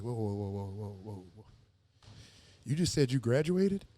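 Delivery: soft low calming pastor; low quiet pastor. Retold or imitated. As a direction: hush him gently, low. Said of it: "And he was like".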